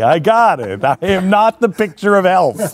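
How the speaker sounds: normal talking voice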